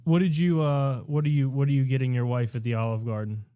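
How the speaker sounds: normal talking voice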